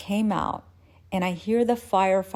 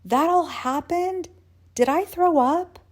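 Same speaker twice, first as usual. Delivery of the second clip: high, rising shocked questions; loud, pitched-up incredulity; horrified disbelief; 9 semitones higher, 4 dB louder than usual. Acted. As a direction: ask in mounting alarm. Horrified questions after learning the truth.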